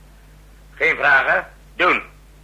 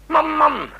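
exasperated; 13 semitones higher than usual. Acted. Commander exasperated at slow staff.

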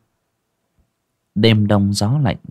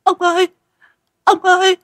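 high, loud, panicked scream to her husband; terrified shriek; 4 dB louder than usual. Acted. Wife shrieking at a human eye in the soup, terrified scream. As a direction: shriek it out in horror, stammering. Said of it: "mà rú lên".

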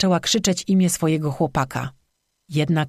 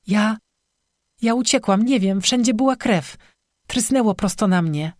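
high, halting frightened confession; acted panic, pitched well up; distraught; 5 semitones higher than usual. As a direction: stumble into it, upset and shaken. Crying girl confesses she ran away.

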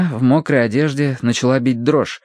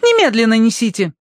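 high imperious command; haughty order; 11 semitones higher than usual. A narrator's reading. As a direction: demand it at once. Tossie commands.

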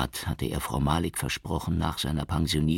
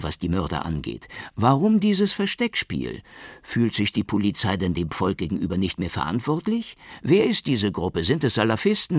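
higher, louder voices of pushy journalists firing questions; pelting questions; 7 semitones higher, 5 dB louder than usual. A narrator's reading. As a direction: fire off questions like a press pack. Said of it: "Die Fragen der Journalisten prasselten auf ihn ein".

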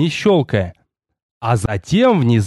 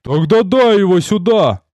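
higher-pitched impatient order; irritated, raised-pitch command; gruff demand; 5 semitones higher than usual. A narrator's reading. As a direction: demand it forcefully. Rikar snatches letter from boy.

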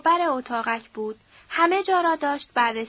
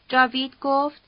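plain reading voice

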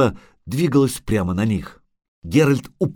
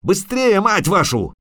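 high, impatient shout; frantic, swearing urgency; yelling; 10 semitones higher than usual. A narrator's reading. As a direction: yell at them to hurry. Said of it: "орал Лютик".

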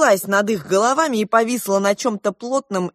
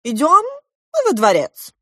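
loud, raised announcement from the king; royal proclamation; 9 semitones higher, 6 dB louder than usual. A narrator's reading. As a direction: proclaim it to the whole court. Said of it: "возвестил король".

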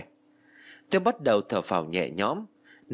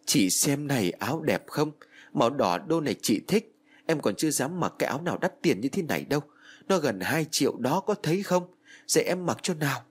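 higher, tearful pleading at the coffin; pleading with dead sister; 5 semitones higher than usual. Acted. Girl at her sister's coffin, pleading tearfully. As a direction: beg her sister to come back.

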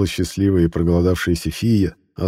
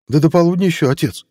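high, light lad's voice; young boy voice; 10 semitones higher, 3 dB louder than usual. Acted. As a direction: answer like a gangly teenage son. Lanky boy answers father.

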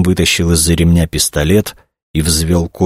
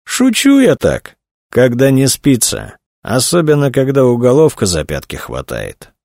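snide, slow; 7 semitones higher than usual. A narrator's reading. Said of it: "ехидно произнёс Митьков".

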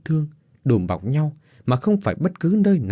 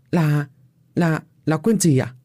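a touch of nervous stammer, slightly higher; stammering nervously; 5 semitones higher than usual. A narrator's reading. Nam, caught off guard, stammering to the old man. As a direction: stutter it out, a little scared. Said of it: "vẻ mặt hơi sợ sệt".

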